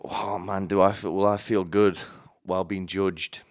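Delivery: usual speaking voice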